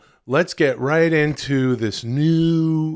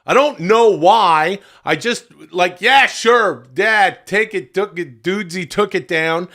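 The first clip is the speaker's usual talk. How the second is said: raised, higher-pitched ranting; agitated venting; worked-up yelling; 4 semitones higher, 3 dB louder than usual. Real, not acted. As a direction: rant loudly, easing off from the peak. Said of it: "Why am I yelling?".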